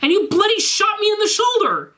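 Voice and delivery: high, squeaky indignant rant; a whiny schoolboy pouring out his grievance; ranting Draco. Acted. Draco complains to Garfield about his hospital stay, high whiny rant.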